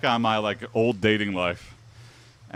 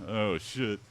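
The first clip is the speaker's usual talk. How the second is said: normal talking voice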